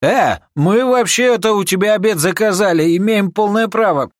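high, loud protest; indignant protest. Acted. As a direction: whine about your rights. Thug protests.

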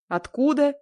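high, loud, incredulous «Откуда»; disbelieving. A narrator's reading. Stranger hearing her home city, loud astonished «Откуда». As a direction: pitch «Откуда» up sharply in disbelief. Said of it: "не поверил он".

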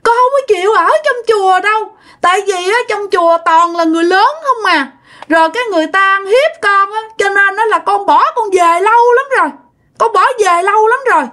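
put-on high, sobbing toddler complaint; crying child voice. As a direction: sulk and cry like a small child left behind. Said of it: "Vừa khóc mà vừa nói nha".

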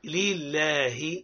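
very slow لله with a clear kasra and a long lam; drawn-out model. Shown as a demonstration. Teacher gives the class لله to repeat. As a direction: stretch لله out for them to repeat. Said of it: "قولوا معايا".

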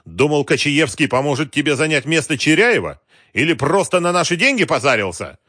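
loud, high-pitched angry questioning; angry accusation. A narrator's reading. Wolf accusing Galkin of cheating, angry. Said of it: "сказал со злостью".

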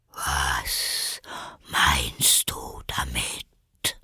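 low, very slow whispery voice; stern, sleepy caterpillar. A narrator's reading. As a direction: demand it slowly, half whispered. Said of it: "fragte die Raupe streng". (streng, tief und schläfrig gehaucht)

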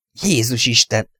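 mild narrated cry of shock; understated startled exclamation. A narrator's reading. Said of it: "kiáltotta Luke".

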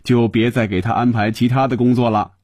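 lightly wheedling, pitch climbing; coaxing plea. Acted. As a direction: plead a favour with playful exaggeration. Coaxing a colleague on the phone.

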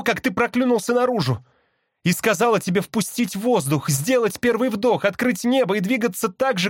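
high, insistent calling, sustained throughout; impassioned appeal. A narrator's reading. Sister bird invokes their shared blood, appealing urgently. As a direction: implore him in a high urgent voice. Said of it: "сестра продолжала ко мне взывать".

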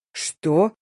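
ordinary delivery

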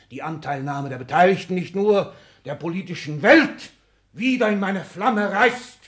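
loud, high, slowing to the end; rising grandeur. Acted. Exzellenz builds his boast to his secretary, loud and high. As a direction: build to a crescendo.

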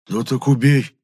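mildly acted whine; moaning. A narrator's reading. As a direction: groan it as an urging. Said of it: "простонал Епифан".

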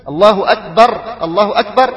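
الله أكبر with the final ra of أكبر stopped silent, akbar; الله أكبر, both ra sounds without a vowel; sukun on the ra. Shown as a demonstration. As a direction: stop dead on the ra of أكبر. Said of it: "تسكين في ال-، في الرائين".

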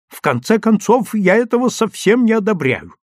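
high, loud indignant voice; shrieky overheard family quarrel; irritated shouting. A narrator's reading. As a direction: complain loudly in exasperation. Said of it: "раздражённо кричала Исфирь Яковлевна".

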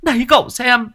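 very high, shrill-free loud ranting woman; angry wife demanding the vandals be jailed; furious wife. A narrator's reading. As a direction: rage about the vandals to the neighbour.

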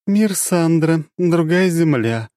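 high, slow weary sigh; tired dark goddess. Acted. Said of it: "вздохнула тёмная".

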